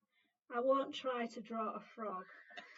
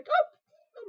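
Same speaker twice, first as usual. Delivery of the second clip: startled oh; 13 semitones higher, 15 dB louder than usual. Real, not acted. Nearly dropped the stick.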